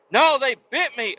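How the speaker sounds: imitating a daughter's high, loud protest; a stubborn kid insisting, high and loud; insistent child. Daughter arguing with dad that dogs bit her, insistent whine. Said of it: "They licked you".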